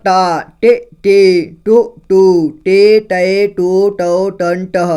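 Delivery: each vowel called out loud and clear, with pauses; loud syllable drill. Shown as a demonstration. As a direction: recite the vowels slowly for the class. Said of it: "ट का देखिए". (ज़ोर से धीरे-धीरे स्वर बोलना)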